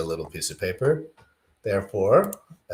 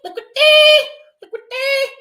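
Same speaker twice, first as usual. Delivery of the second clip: high, loud squawking bird call on each Liquidity; 11 dB louder than usual. Pretend. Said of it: "It's like a bird call".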